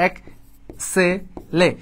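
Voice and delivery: অ্যাক্সিলারেশন split into এক, সে, লে with pauses between; syllable by syllable. Shown as a demonstration. Teacher to students, showing how to split অ্যাক্সিলারেশন: syllable by syllable. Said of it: "এইভাবে করে ভেঙে ভেঙে পড়ো".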